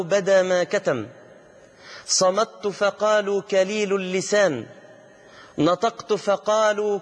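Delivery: slow, drawn-out chanting verse; measured singsong declamation of poetry; poem recitation. Acted. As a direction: recite each verse with long held vowels, falling at line ends.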